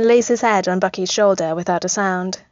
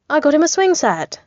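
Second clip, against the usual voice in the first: higher-pitched indignant protest; Tony defending his gift, pitched up and protesting; 8 semitones higher, 3 dB louder than usual. A narrator's reading. Tony insisting his swing set is enough, protesting. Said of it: "Tony protests".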